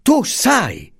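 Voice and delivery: high, drawn-out accusing cry; grido. A narrator's reading. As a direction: cry out slowly, pitched far up without much volume. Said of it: "gridò Franco". (grido acuto d'accusa)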